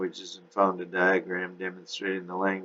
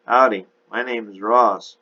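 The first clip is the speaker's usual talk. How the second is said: lightly voiced Texas gentleman; Texas accent; 3 semitones higher, 7 dB louder than usual. A narrator's reading. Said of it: "in a heavy Texas accent".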